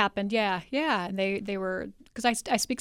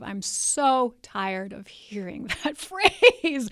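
drawn-out SO, rising on the last word; fed up, stretching the words with a laugh; exasperated emphasis; 3 semitones higher, 6 dB louder, much slower than usual. Real, not acted. Exasperated at a repeated phrase.

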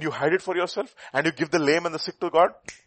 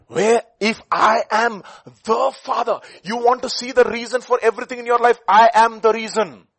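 preaching at full force; 9 semitones higher, 10 dB louder than usual. Acted. Preacher voicing God to his congregation, booming. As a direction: thunder out God's words.

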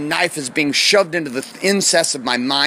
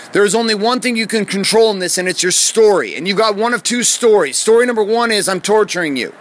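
raised, emphatic coaching voice; intense, higher-pitched insistent speech; forceful instructor lecture; 5 semitones higher than usual. Retold or imitated. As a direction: drive each point home as the instructor. Instructor lectures him on his story.

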